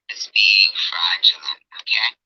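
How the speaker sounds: whispered, slowed accusation; loud whisper. Real, not acted. Accusing E-Beggars.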